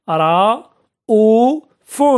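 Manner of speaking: رؤوف very slow, broken into long separate syllables. Shown as a demonstration. Teacher sounding out an example of hamza, رؤوف syllable by syllable. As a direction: stretch each syllable of رؤوف, pausing between them.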